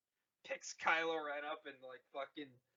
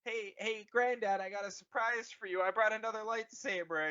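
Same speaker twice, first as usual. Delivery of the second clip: higher, teasing taunt; mocking grandson; 6 semitones higher than usual. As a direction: show off a nasty surprise. Kylo taunts granddad in story.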